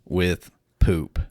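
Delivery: ordinary delivery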